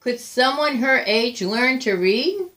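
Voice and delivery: usual speaking voice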